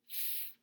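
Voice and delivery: F as a bare breath "fff", not the letter name; long soft "fff" for F; letter-sound demo. Shown as a demonstration. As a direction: sound out F softly, no vowel. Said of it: "P H says".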